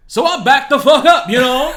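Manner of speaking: loud, high mock confrontation; aggressive comeback. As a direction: shout it like squaring up. Listener imagines an aggressive comeback.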